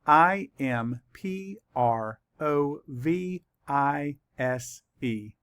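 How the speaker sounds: very slow, spaced-out letters; letter by letter, leaving time to write; spelling for dictation. Shown as a demonstration. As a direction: spell slowly, pausing after each letter. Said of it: "let's write it".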